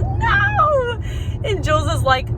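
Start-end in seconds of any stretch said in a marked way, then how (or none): 0.0-1.0 very high, drawn-out mock cry; freaking-out wail. Imitating Brett just shot in the shoulder, high panicked wail. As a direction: squeal the word out in pretend agony. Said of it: "clearly he's freaking out a bit".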